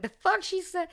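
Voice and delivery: very high, incredulous squeak; mock disbelief. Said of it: "looking at her like".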